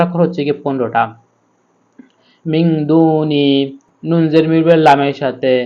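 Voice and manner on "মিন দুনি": slow "মিন" with held nasal n before "দুনি"; ghunnah. Shown as a demonstration. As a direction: hum through "মিন", then stretch "দু". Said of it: "গুণনা করে পড়তে হবে". ("মিন" গুণনা করে টেনে)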